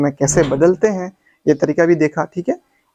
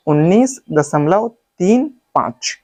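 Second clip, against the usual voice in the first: correct reading demo; slower than usual. Shown as a demonstration. Teacher showing students the right way, digit-by-digit reading. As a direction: read तीन, पाँच as separate digits. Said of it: "इसको पढ़ने का तरीका मैं बताता हूँ".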